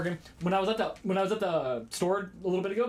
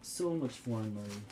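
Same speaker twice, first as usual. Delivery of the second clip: low mock voice; 7 semitones lower, 8 dB quieter than usual. Gloating over the foreign money stash, low mock voice. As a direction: growl it like a greedy miser. Echoing own boast in mock voice.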